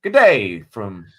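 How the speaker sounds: a mock Australian accent, slow and loud at first. Reading a listener's Brisbane greeting in a mock Australian accent. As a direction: put on a hammy Australian greeting. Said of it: "That's my accent".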